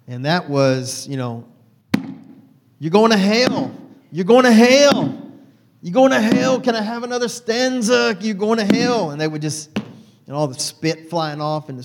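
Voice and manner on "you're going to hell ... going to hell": shouted, high, loud preacher parody; preacher yelling at the congregation; mock hellfire preacher. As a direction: thunder it like a fire-and-brimstone preacher. Mimicking Baptist preacher.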